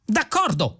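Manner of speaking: high-pitched yelp; excitable squawk; shrieked concession. A narrator's reading. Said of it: "strillò Vrumfondel".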